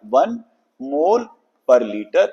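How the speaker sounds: normal talking voice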